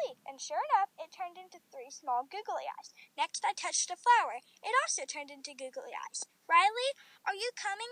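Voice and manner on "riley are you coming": higher, louder call to a friend; storybook call, lifted and carrying. A narrator's reading. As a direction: call over to someone playing. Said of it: "Lena called out".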